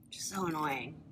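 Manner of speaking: annoyed whispered aside; whispering. Real, not acted. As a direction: whisper the gripe. Muttered complaint about pencil.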